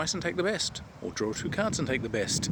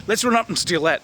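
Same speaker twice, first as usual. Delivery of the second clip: eager mock whisper-to-shout; 7 semitones higher, 5 dB louder than usual. Players eyeing the meat pie.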